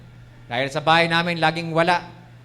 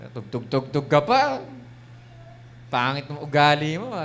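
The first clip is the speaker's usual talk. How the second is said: accusing devil voice. Acted. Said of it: "Ganun ang klase ng boses ng demonyo".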